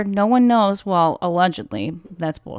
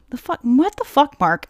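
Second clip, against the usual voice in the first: high, louder shocked outburst; outraged disbelief; 6 semitones higher, 4 dB louder than usual. Real, not acted. Host stunned by the friend's answer, outraged. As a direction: blurt it in disbelief.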